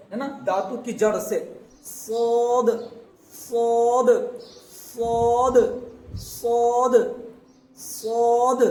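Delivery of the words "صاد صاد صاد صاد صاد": صاد said louder in a heavy, full voice; صاد with a heavy emphatic s; heavy saad. Shown as a demonstration. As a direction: give صاد a weighty, thick s. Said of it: "بھاری آواز میں".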